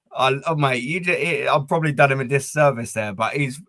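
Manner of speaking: ordinary talk, nothing marked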